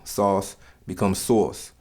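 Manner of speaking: slow demo, second "sauce" drawn out and stressed like "sawce"; New York vowel. Shown as a demonstration. Teaching the stressed New York AU, slow "sawce" demo. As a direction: lean hard on the "aw" of the second "sauce". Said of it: "become more stressed and nasal".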